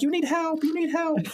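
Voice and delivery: very high, pushy helper voice; insistent eagerness. Acted. Ex-wife barging into men's bathroom to help, high insistent eagerness.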